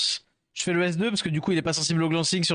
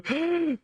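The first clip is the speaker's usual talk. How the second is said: sharp gasp; 11 semitones higher than usual. Real, not acted. Sudden gasp during game.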